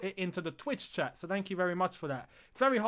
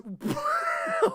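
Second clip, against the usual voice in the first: sudden high laugh of relief; high nervous laugh; 4 dB louder than usual. Real, not acted. Streamer laughs off Kepa's scare, high laugh. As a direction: cracks up nervously.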